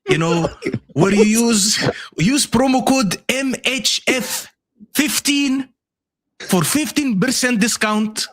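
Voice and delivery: slow, high-pitched accent impression of Mo. Host mimicking Mo to plug jerseys, Mo accent. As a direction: read the promo in a put-on Mo accent. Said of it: "do it in the Mo accent".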